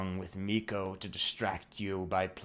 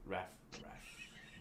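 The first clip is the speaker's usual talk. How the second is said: usual speaking voice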